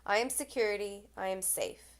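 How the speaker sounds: measured poetry reading, lines falling away at the ends; poem recitation. Acted. Poet reading their poem Risk to viewers, solemn recitation. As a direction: recite it as a poem, pausing between the images. Reads own poem aloud.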